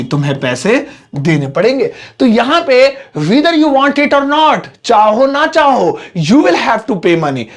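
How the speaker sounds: ordinary talk, nothing marked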